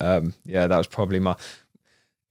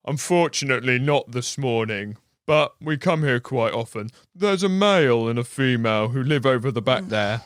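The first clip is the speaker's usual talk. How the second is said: Henry; 3 semitones higher than usual. Retold or imitated. Henry spins story to walker.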